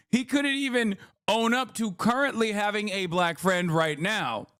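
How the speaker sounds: usual speaking voice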